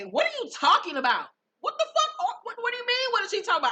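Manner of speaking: high, loud mock outrage; indignant rant. Acted outraged argument in a recap.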